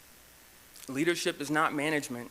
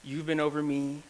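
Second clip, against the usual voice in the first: ordinary talk, nothing marked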